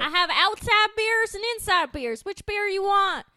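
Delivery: high, loud hillbilly drawl; slow rustic drawl. Retold or imitated. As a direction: brag like a backwoods good ol' boy. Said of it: "The redneck voiceover".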